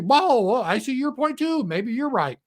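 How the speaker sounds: high, wide-eyed parody of Trump; mock flip-flopping Trump. Trump swayed by European leaders, mock wide-eyed voice. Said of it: "Then he hears European leaders".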